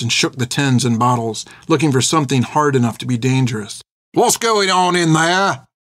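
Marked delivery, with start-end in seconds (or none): 4.1-5.7 slow, high, drawn-out call; calling from another room. A narrator's reading. Soldier to those in the kitchen, calling out slowly. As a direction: call through to the next room, questioning. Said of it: "called a voice from the other room".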